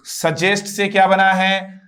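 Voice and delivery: normal talking voice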